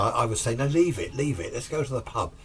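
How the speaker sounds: ordinary talk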